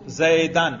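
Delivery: زیدن ending in "-an", as zaydan; accusative ending. Shown as a demonstration. Teacher shows students the accusative case, زیدن pronounced zaydan. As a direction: say زیدن with a final a-n sound. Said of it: "میرے تلفظ سے ہی آپ کو پتہ چلے گا".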